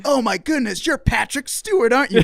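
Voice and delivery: high-pitched smart-aleck gushing; put-on teen nerd voice, pitched well up; nerdy fifteen-year-old. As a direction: play a cheeky nerd kid starstruck. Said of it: "'Cause I'm a smart aleck, stupid, nerdy fifteen-year-old".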